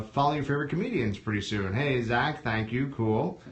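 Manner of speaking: ordinary delivery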